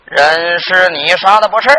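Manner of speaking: low, slow, stately interrogation; presiding magistrate. Acted. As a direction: draw the question out heavily from the bench. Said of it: "你爹往堂上一坐". (官老爷低沉缓慢审问)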